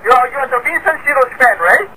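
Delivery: ordinary talk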